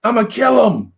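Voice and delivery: high, loud, raging shout through a cell door; strained high yell of a furious inmate; inmate yelling threats. Retold or imitated. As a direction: scream it like a caged man wanting out. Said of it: "he would be, like, shaking his door".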